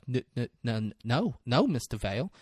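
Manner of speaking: soft, low stammered denial; stammering. Retold or imitated. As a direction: act out a timid stutter. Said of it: "if you have a stuttering problem".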